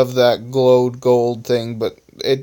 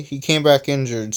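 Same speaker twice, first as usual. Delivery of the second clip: ordinary delivery, nothing marked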